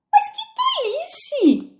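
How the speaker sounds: very high, very slow, firm retort; loud and decided. A narrator's reading. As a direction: stand your ground, drawn out and firm. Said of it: "em voz alta e muito decidida". (retruca decidida, muito aguda e bem lenta)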